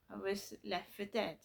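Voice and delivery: ordinary talk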